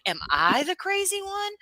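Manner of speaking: very high-pitched, exasperated plea; incredulous self-questioning. Acted. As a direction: ask it way up high, in mock disbelief. Incredulous self-doubt, wants it as button.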